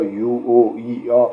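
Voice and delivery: name each vowel sound clearly; sample vowels spoken out. Shown as a demonstration. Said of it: "هیچ حرف آ".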